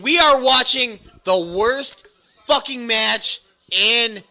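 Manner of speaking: upset loud rant. Real, not acted. Co-host ranting to Danny about the worst match, loud and upset. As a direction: hammer each ever down the line. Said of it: "I'm so upset".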